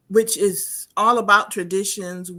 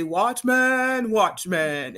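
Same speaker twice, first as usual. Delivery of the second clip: deep, melodious voice; much slower than usual. Retold or imitated. Imitating the man at the door calling in the dark, melodious. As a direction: call it out melodiously, dropping deep on the repeat. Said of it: "had this deep, melodious voice".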